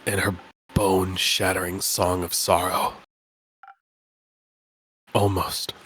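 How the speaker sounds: low spooky voice. Acted. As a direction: sound like a weary ghost. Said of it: "the perfect voice for him".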